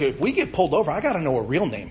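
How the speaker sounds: mock demand. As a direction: push hard for the truth.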